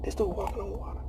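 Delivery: hushed, breathless whisper; mock awed murmur of the crowd. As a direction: whisper it in disbelief. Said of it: "everybody was like".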